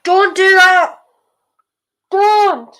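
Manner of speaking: high, loud plea at the screen; pleading shout. Real, not acted. Fan shouts at a player on screen, pleading.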